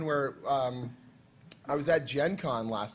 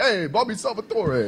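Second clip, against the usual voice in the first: higher, louder tough-guy mobster call; gangster voice; 6 semitones higher, 4 dB louder than usual. Retold or imitated. Mocking author as mobster, gangster voice. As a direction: play it like a Brooklyn wiseguy greeting. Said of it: "it sounds like a gangster".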